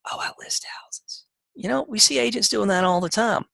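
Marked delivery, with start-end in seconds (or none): 0.1-1.2 "Oh, I list houses" breathed out in a whisper; timid whisper. Shown as a demonstration. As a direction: shrink away as you say "Oh, I list houses". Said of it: "versus how, saying".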